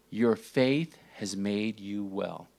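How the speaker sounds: slow and soft, leaning on faith; Jesus speaking the healing gently, slow and soft; gentle. Acted. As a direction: say it softly, like a blessing. Jesus' words, repeated gently.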